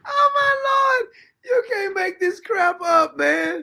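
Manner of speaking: laughing outcry. Real, not acted. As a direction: howl it out in disbelief, cracking up. Cracking up at the clip.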